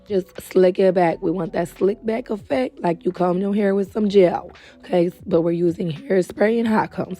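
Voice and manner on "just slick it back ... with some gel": usual speaking voice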